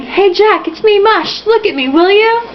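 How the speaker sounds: mock shout.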